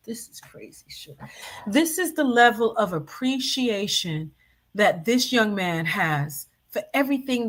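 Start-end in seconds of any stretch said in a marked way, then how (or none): 0.1-1.2 breathy whisper under the breath; whispered aside. Real, not acted. Watching the clip, she whispers her disbelief to herself.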